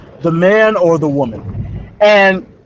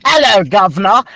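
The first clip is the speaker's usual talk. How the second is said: hammed-up fake English accent; bad English accent; 3 semitones higher than usual. Student imitating woman mocking the jogger, bad English accent. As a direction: put on a silly English accent. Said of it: "doing the stupidest fucking English accent".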